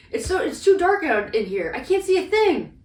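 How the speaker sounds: high, loud mock complaint; imitating Sokka. Quoting a character at the wall with the drill, hammed-up complaint. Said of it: "Sokka's like".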